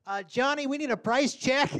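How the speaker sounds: high, loud store clerk call; mock cashier call. Grocery clerk calling a coworker, high loud call. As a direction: call across the store for help. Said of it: "they do".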